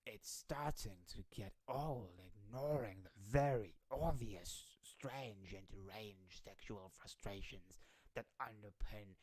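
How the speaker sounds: slow, put-on orator's voice; Ted Cruz. Streamer reading the politician's tweet aloud, slow put-on voice. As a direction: read it very slowly, drawing out each phrase. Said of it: "I don't know his voice".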